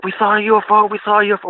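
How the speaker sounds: high-pitched retold excitement; freaking out. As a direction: imitate two friends blurting out big news in a panic. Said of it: "We're freaking out".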